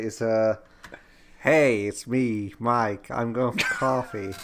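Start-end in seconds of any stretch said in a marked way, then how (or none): 1.4-4.3 slow, lightly put-on American accent; Mike accent. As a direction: attempt a drawn-out imitation of an American friend. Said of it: "Let's hear your best Mike accent".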